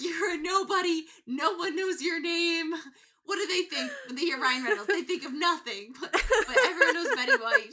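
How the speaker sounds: high, loud hammed-up rant; mock tirade. Betty berating Ryan in the hallway, loud mock tirade. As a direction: belittle him in a shrieking old-lady tirade. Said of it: "she's like".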